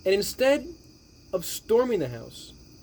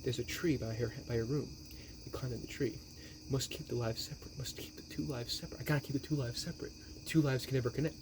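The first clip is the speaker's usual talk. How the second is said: soft, low mumbling under the breath; mumbling to himself; 4 semitones lower, 11 dB quieter than usual. Retold or imitated. Retelling the killer talking to himself, mumbled. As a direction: mutter it to yourself like a man lost in thought. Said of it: "he just mumbles to himself".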